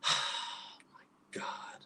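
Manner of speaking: breathless whispered my God after a sigh. Retold or imitated. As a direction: whisper it, drained and stunned. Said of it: "And I was like".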